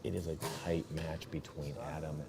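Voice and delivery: hushed golf commentator voice. Acted. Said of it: "I'll try to do my golf voice".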